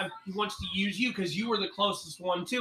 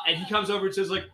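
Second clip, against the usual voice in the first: normal talking voice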